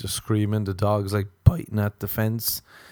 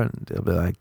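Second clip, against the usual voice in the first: ordinary delivery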